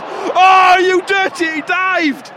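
high, loud cry of disbelief; outraged shout. Real, not acted. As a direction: yell at the diving keeper. Outrage at a dive.

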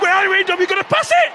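loud, very high yell; real frustrated terrace shouting; exasperated shout. Real, not acted. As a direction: bellow your demand at the player. Yelling at players during match.

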